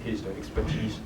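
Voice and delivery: ordinary talk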